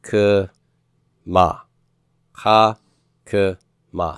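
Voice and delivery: "Cha k ma" as khah... kuh... mah, a pause after each; syllable-by-syllable pronunciation. Shown as a demonstration. Teacher sounding it out for class, syllable by syllable. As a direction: break "Cha k ma" into three isolated syllables. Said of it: "Say that with me".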